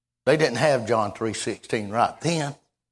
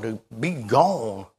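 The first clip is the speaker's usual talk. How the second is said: ordinary talk, nothing marked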